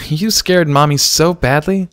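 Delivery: mock scold a little one; scolding mother. A narrator's reading. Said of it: "gave Dinky the stink eye".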